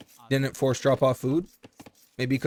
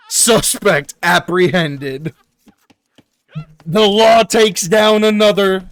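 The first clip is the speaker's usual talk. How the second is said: slow, then a louder, higher lawman's proclamation; sheriff roleplay; 4 semitones higher, 8 dB louder, slower than usual. Acted. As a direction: play the sheriff announcing the arrest. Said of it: "trying to roleplay".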